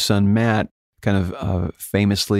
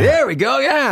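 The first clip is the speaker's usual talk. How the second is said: much higher, louder mock praise; imitating an impressed producer; 3 dB louder, slower than usual. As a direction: squeak out an excited imitation of the producer. Producer reacting to the high note.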